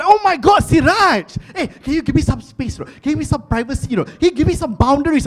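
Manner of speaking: high, loud, flustered mock wife; startled wife. Wife caught changing yells at husband, high and loud. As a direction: shriek at him for barging in. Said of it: "she was so like".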